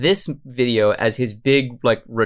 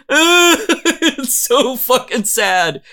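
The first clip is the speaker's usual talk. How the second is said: laughing; 11 semitones higher, much slower than usual. Real, not acted. Laughing at cringe video.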